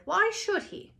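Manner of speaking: giant's quick dismissal; Hagrid brushing off. Acted. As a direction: wave it away. Hagrid denies.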